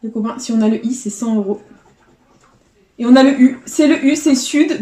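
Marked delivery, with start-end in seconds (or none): 3.0-4.8 louder, higher burst of joy at the win; excited win. Real, not acted. Scratching a ticket for viewers, thrilled to find the U, excited shout. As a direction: cheer out the small win, loud and high.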